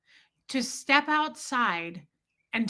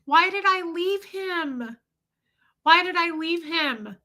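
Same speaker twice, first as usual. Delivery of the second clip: guilty grief; 7 semitones higher than usual. Real, not acted. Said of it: "I felt so guilty".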